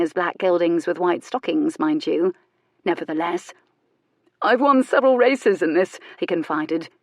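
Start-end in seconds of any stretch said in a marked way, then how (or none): 4.4-6.0 a touch higher, louder, pleased with himself; lightly voiced boastful young lord. A narrator's reading. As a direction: voice Camber bragging a little. Camber boasting in story.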